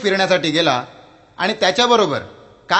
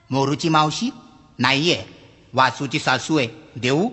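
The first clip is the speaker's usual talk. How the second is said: a lower, deadpan offer; dry librarian; 4 semitones lower, 3 dB quieter than usual. Acted. Librarian offers a different book, low and deadpan. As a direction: offer the swap without a smile.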